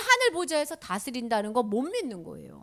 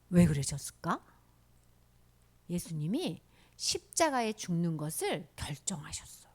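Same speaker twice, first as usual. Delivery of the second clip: ordinary talk, nothing marked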